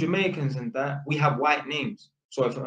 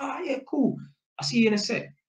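much higher easygoing okay; breezy and bright, pitched up; friendly upbeat; 9 semitones higher than usual. Retold or imitated. Electrician agreeing with tenant, high breezy.

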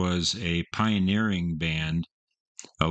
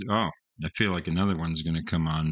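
usual speaking voice

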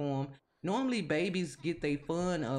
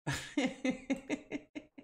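high laugh; 10 semitones higher than usual. Real, not acted. Laughing at failing kids.

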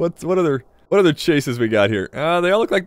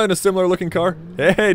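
ordinary talk, nothing marked

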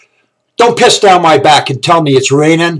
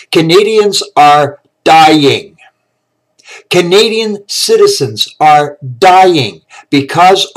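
each word hammered out slowly; slow emphasis; slower than usual. Real, not acted. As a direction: drag it out, hitting every word.

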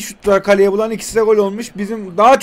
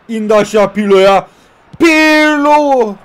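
hyped play-by-play shout; excited commentary; 5 dB louder, slower than usual. Real, not acted. Excited football game commentary.

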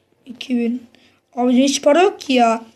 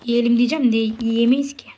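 normal talking voice

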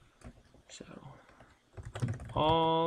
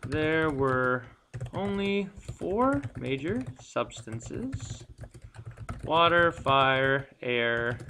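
slow dictation; much slower than usual. Shown as a demonstration. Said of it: "let's see".

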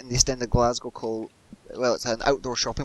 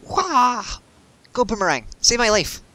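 excited shout; 10 semitones higher, 5 dB louder, slower than usual. Real, not acted. Panicked throw in the game.

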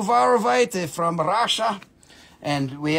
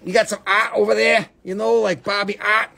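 put-on playful R-less Boston accent; 2 semitones higher than usual. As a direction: talk like a Bostonian, dropping Rs. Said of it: "that's how they say it in Boston".

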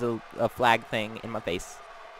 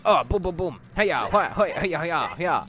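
mock battle cries; 4 semitones higher, 4 dB louder than usual. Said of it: "and then I was like".

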